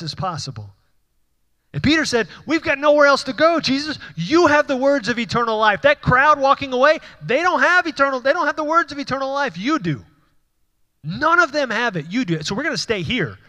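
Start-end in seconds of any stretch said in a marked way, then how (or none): 1.7-12.2 higher and louder, fervent pulpit cry; preaching at full force. Acted. Pastor voicing Peter's loyalty to his congregation, impassioned preaching. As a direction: proclaim it to the back row.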